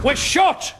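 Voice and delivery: loud, high shout through the shop; calling out. Acted. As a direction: call it out to the door. Calling out to customers at door.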